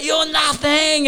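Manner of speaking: high, drawn-out mock holler; drunken yelling. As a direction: stretch it out like a drunk yelling from a boat. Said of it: "He just yelling out".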